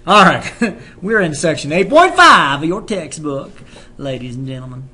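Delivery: loud southern class opener; southern accent. Acted. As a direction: announce it with a Southern twang. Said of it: "I do like my southern accent".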